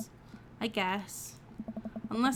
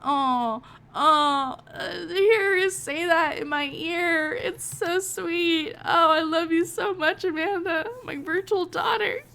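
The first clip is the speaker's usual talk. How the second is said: much higher, louder, slow gushing; 8 semitones higher, 7 dB louder, slower than usual. Real, not acted. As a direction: melt and gush over her. Overwhelmed by game daughter's love.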